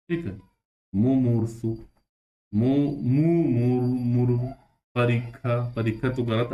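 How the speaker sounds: ordinary talk, nothing marked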